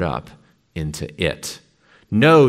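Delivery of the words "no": a full-throated, high, loud scream of refusal. A narrator's reading. Meg screams no at the brain controlling her brother. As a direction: scream it out at the top of your lungs. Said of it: "she screamed at the top of her lungs".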